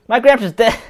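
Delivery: put-on high kid voice. Voicing kid NPC continued.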